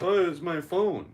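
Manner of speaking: high, loud, slow mock innocence; fake innocent. Friend lying to the cop about his pocket, mock innocent. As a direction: play dumb to the cop, high and drawn out.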